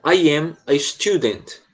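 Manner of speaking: slow, clear teacher read of I am Rosângela; slow model line. Shown as a demonstration. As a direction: read it slowly for the class to repeat. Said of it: "Eu falo uma, você repete". (leitura lenta e clara para repetir)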